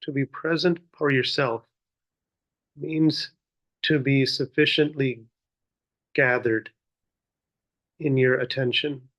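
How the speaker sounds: measured meditation guidance with long pauses; guided meditation. Real, not acted. Teacher leading seated listeners in muraqabah, guiding contemplatively. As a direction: guide them inward, one unhurried phrase at a time.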